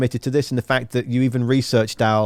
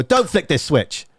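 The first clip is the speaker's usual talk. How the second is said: mock stern order; 4 semitones higher than usual. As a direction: imitate a captain's sudden teasing command. Captain's sudden mock order retold.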